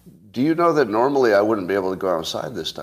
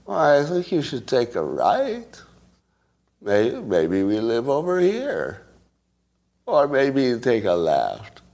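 slurred, slow, higher tipsy voice; a woozy drunk lady giving directions; 3 semitones higher, slower than usual. Pretend. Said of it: "your navigation should be also on drunk voice".

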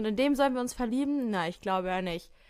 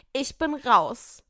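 walking out; 2 semitones higher, 5 dB louder, much slower than usual. Acted. Model storms off.

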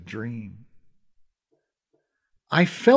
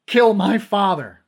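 slow, high, loud denial; slowed, high desperate scream; 7 semitones higher, 6 dB louder, slower than usual. Acted. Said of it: "I screamed".

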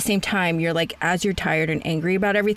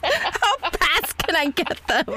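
laughing question; 8 semitones higher, slower than usual. Real, not acted. Laughing at herbs joke.

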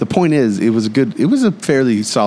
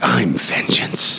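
very slow, whispery growled menace; Pattinson's Batman; 2 semitones higher, much slower than usual. Acted. Batman announcing himself to criminals, slow imitated menace. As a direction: draw it out in a hushed hero voice. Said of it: "Pat Bat style, where he's like".

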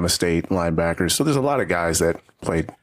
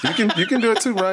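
laughing; 12 semitones higher than usual. Real, not acted. Laughing while teasing Ryan.

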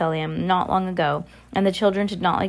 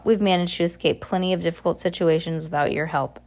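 plain reading voice

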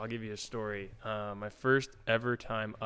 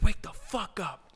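high, loud, slow mock bark; retold yell; 11 semitones higher, 7 dB louder, slower than usual. Teammate barking at the goalie to wake up. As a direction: bark it slowly at the rookie. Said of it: "he's like".

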